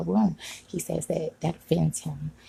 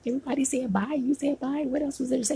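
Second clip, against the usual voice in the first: higher-pitched, retold protest; indignant retort; 8 semitones higher than usual. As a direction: replay a baffled self-defence in a raised pitch. Retelling her protest in the argument.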